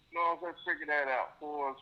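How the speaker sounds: ordinary talk, nothing marked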